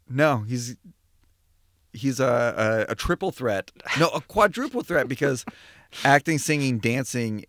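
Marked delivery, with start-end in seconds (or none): none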